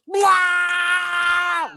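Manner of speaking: long, high draconic roar; kobold roar. Acted. Kobold roars right in the panther's ears, full-force shriek-roar. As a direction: let out one huge, high-pitched creature roar. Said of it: "and then just roars".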